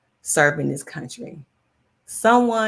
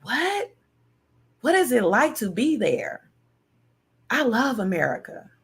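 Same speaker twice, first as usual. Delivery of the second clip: amazed foreigner; 4 semitones higher than usual. Retold or imitated. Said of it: "and they say".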